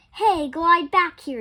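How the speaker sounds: loud, slowed shout after her; wolf yelling. A narrator's reading. The wolf chasing Little Red yells after her. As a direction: call her back with a loud yell. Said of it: "yelled the wolf".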